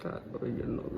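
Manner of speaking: mumbled under the breath, unvoiced; shy whisper, barely saying it. Husband whispers the sweet line his wife asked for. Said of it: "બોલો".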